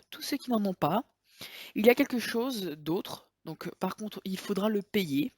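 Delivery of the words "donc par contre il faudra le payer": ordinary delivery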